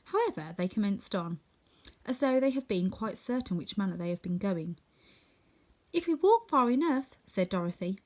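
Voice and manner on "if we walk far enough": lightly voiced hopeful girl; a hint of a young girl. Dorothy voiced in story.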